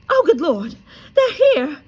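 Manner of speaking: high, loud frantic gasp; loud high frantic aunt. A narrator's reading. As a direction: panic as the bell rings. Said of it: "said Aunt Petunia frantically".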